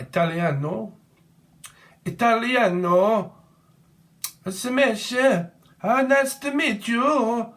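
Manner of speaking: hammed-up Italian, high and loud; mock Italian accent. Reader mocking an Italian viewer, high and loud with a hammed-up Italian accent. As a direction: play a campy Italian greeting.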